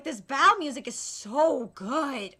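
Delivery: ordinary delivery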